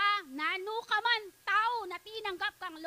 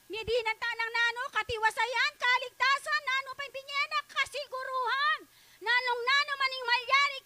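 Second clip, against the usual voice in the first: high, loud preacher's call to the congregation; fervent preaching; 7 semitones higher, 6 dB louder than usual. Acted. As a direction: preach it at full force, rising. Preaching at full force. (masigasig na pangangaral nang malakas)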